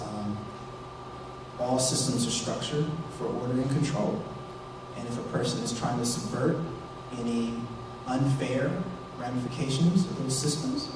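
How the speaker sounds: very soft, low, hesitant delivery; retold careful answer. New hire answering why sociology matters, quiet and careful. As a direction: re-enact a cautious answer, hushed and low, with pauses.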